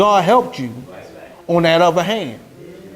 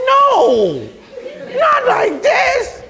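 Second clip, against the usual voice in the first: much higher, slow, laughing shout; hammed-up laughing protest; laughing dismay; much slower than usual. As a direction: wail it through laughter. Horrified at old crush.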